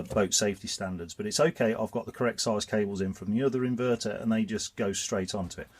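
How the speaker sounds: ordinary talk, nothing marked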